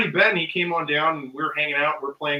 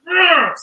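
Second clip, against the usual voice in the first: high, loud burst of Hulk-like rage; enraged growling shout; 13 semitones higher, 10 dB louder than usual. Retold or imitated. Storyteller relives pointing at the man in rage, roaring. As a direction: re-enact a wordless angry roar.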